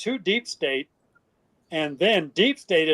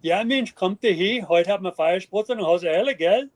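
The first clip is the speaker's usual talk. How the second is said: Bavarian dialect; 2 semitones higher than usual. Shown as a demonstration. Inviting friends over in Bavarian dialect. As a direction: slip into broad Bavarian. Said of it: "If I was Bavarian".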